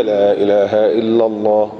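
low, slow words through motionless lips; low level murmured chant; lips held still. Shown as a demonstration. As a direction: say it covertly without moving the lips. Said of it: "الشفتين ما بيتحركوش".

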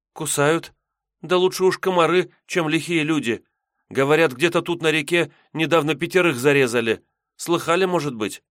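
put-on high, uneasy young maiden; high girl voice. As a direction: tell it like a scared girl. Maiden talks of robbers.

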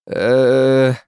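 high, loud, drawn-out bleating hesitation; nervous bleat. A narrator's reading. Klazen half turned to flee, bleating nervously. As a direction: bleat timidly like a sheep. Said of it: "заблеял Клазен".

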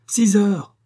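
high, loud correction; sharp contradiction. A narrator's reading. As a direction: fire back the correction loudly. Wife contradicts husband on time.

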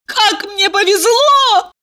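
put-on high slow girly gush; bubbly sorority girl. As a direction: swoon over her luck. Valeria gushing.

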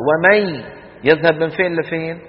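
ordinary delivery

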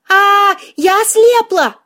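high, slow wail of horror; panicked yell. A narrator's reading. Heroine believes she has gone blind, yelling in panic. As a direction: cry out in drawn-out panic. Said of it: "прекрати орать".

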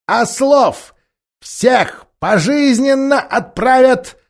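high, slow, self-important proclamation; a sly ape lording it over the crowd; pompous ape voice. Acted. The ape announces to the beasts.

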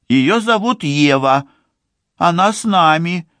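put-on high, thin girl's voice; small timid young woman, pitched high. A narrator's reading. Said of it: "тонким голосом говорит Ливи".

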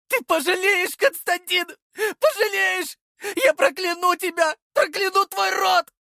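high shrieking curses; screaming curses. A narrator's reading. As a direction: scream curses as she is dragged off. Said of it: "кричала проклятия". (кричит проклятия)

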